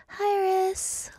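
slow high register demo. Shown as a demonstration. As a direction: float it way up high. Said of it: "High Iris or low Iris?".

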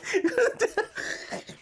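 long high-pitched laughter. Real, not acted. Host still laughing at the cover, high and long.

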